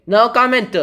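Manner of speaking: high, loud stonewalling reply; firm "no comment". Acted. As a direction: shut the question down loudly. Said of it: "That's a pretty good no comment".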